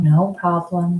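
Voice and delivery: ordinary talk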